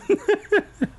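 high, stuttering giggle; laughter. Real, not acted. Narrator cracking up over the awesome flying, giggling. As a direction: burst into a high cackling giggle.

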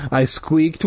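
ordinary delivery, nothing marked

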